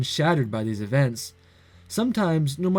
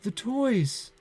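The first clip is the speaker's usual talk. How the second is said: despairing cry; 7 semitones higher, 5 dB quieter than usual. Acted. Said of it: "Dewdrops begin to form".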